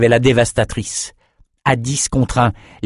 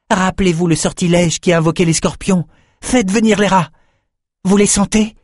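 higher, focused instructing; Ambre's voice; 9 semitones higher than usual. A narrator's reading. Ambre leading the spell, higher guiding voice. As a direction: guide them through the spell.

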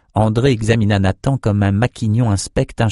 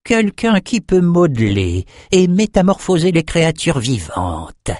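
high-pitched boast, dropping on the last word; self-satisfied sorcerer explaining; proud of himself; 9 semitones higher than usual. A narrator's reading. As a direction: show off your craft with relish. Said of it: "fier de lui".

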